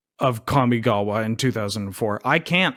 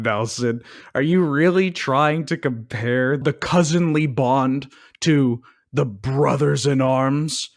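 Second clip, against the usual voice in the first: usual speaking voice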